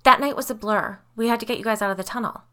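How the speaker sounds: high, loud, hurried protest; insistent. A narrator's reading. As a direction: rush to defend yourself before anyone can speak. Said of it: "Claire insisted".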